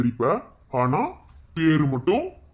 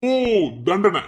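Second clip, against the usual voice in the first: mocking scoff; 6 semitones higher, 4 dB louder, slower than usual. Mocks her word punishment.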